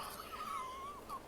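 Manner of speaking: very high, breathy squeal of laughter. Real, not acted. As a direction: burst into a thin high laugh. Cracking up at the scene.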